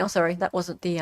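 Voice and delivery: normal talking voice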